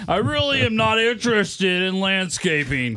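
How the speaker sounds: slow, drawn-out mocking echo; exaggerated slow-talker impression; mimicking co-worker. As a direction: drag out the refusal to tease him. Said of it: "That's how you sound".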